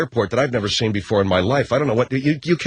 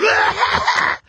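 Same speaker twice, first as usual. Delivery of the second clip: very high, piercing held-in scream; imitated shriek. Husband imitating wife's vomiting, piercing shriek. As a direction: imitate a possessed woman screaming. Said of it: "screaming like she's freaking Linda Blair".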